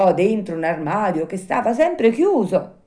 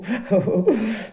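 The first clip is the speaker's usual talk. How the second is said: nervous strained chuckle; forced laugh; 6 semitones higher than usual. A narrator's reading. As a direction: squeeze out a fake laugh. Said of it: "sforzandosi di ridere". (risatina forzata)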